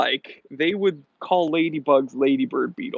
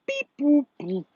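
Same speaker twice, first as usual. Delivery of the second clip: high beeping robot voice; squeaky robot beeps; 11 semitones higher than usual. Pretend. Robot noises on stream.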